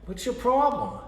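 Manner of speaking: high-pitched ventriloquist dummy; high squeaky puppet voice. Acted. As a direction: squeak out an indignant question. Puppet voice quarrel with himself.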